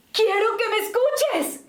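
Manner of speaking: high, drawn-out emphatic call; slow, high, impassioned plea; emotional demo. Shown as a demonstration. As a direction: project it with big emotion. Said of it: "le vamos a poner una emoción".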